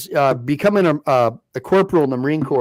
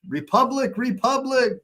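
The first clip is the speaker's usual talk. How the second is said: high, ringing hail of the Republic; mock Roman cheer; 10 semitones higher than usual. Hammed-up legionnaire hailing his Republic to the audience. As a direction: proclaim it like a legionnaire.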